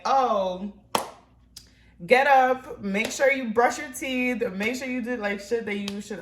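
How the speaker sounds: loud, high mock nagging; nagging mom. Vlogger imitating a mom telling viewers what to do, nagging. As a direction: order them around like a bossy mom. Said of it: "I'm not your mom".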